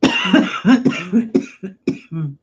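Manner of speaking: loud hacking cough; hard cough. Real, not acted. Coughing fit on stream.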